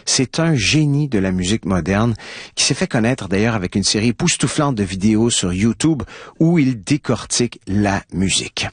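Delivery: ordinary talk, nothing marked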